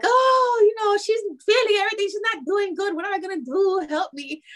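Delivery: high, hammed-up mom despairing over a failing child; imitating West Indian mom. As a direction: play the worried West Indian mother begging for help. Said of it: "West Indian parents love to be like".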